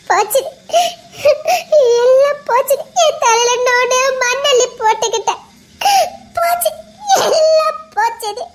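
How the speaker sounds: put-on a girl weeping that her life is ruined; breaking down crying. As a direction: cry it out, broken and hopeless. Breaks down, all is lost.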